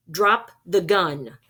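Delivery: slow loud command. Acted. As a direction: order it firmly and slowly. Ghost orders the gunman.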